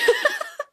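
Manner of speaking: a quick high squeal of a laugh. Real, not acted. Joking to listeners about being grateful, a high laugh. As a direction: laugh at her own crude joke.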